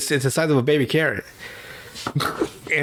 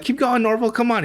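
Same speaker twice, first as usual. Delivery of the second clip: laughing encouragement; 9 semitones higher than usual. Retold or imitated. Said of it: "Velma's laughing".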